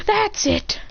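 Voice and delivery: high-pitched acted exasperation; fed up. Boy who has had enough, high exasperated voice. Character reaches his limit.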